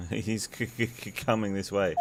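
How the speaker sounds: stuttering in mock fear; acted nervous stutter; frightened stammer. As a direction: stammer out the words as a tiger approaches. Character spots the tiger, stammering.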